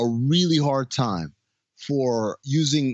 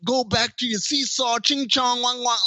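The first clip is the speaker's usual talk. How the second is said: mocking movie dialogue; 10 semitones higher than usual. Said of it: "I'm like".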